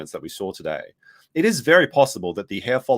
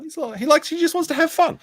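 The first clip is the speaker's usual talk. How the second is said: high fond voice; 3 dB louder than usual. Acted. Fond about Captain.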